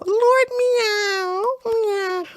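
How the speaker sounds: high, meowing cat voice; high mewing Lord Meow; mock cat meow. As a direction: meow the name like a kitten. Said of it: "What a nice name".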